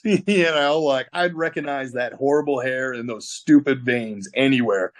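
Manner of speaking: ordinary delivery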